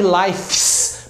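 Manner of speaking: "lives" said with F kept, like "lifes"; forced F-S ending. Shown as a demonstration. Teacher shows what won't come out, forced FS ending. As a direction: force an unvoiced FS onto the end of "lives". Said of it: "vai ter que forçar".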